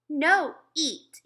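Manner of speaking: put-on high, loud little-kid demand; a stubborn toddler pushing away his plate; bossy small boy shouting up at his mother; toddler refusing food. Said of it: "he told my mother".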